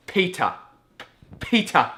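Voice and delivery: "Peter" high and loud; "Peter" over-enunciated for someone not understanding; exasperated repetition. Retold or imitated. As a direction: punch out "Peter" as one separate word. Repeating name for baffled listener.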